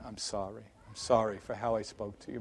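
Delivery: low, very soft apology; subdued apology. Retold or imitated. Father apologizing to his son, quiet and low. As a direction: say sorry quietly, voice dropped low.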